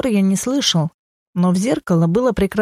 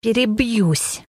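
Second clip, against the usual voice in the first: spiteful; 4 semitones higher, slower than usual. A narrator's reading. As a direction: draw it out with a touch of spite. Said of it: "неожиданно зло произнесла она". (зло, но негромко, с растяжкой)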